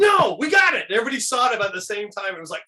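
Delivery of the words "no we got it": high, loud burst of discovery; excited cry. Retold or imitated. Friends in the car spot the perfect toast, high excited cry. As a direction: yell it like you just struck gold.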